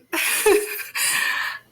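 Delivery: real wordless sob; sobbing. Real, not acted. Host too overcome to finish thanking viewers, sobbing. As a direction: break down in a breathy sob. Grieving host breaks down in tears.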